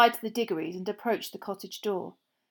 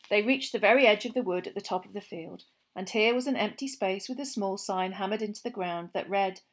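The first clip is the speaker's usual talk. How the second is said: usual speaking voice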